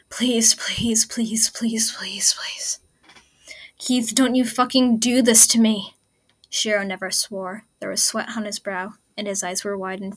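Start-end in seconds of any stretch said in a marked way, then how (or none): 0.1-2.8 breathy pleading whisper, fading; desperate whispered prayer. Acted. Lance begging silently for Keith to live, whispered pleading. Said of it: "screaming through his mind like a prayer". 3.8-5.9 loud, furious desperate demand; desperate angry plea. Acted. Shiro reviving Keith, furious desperate shout.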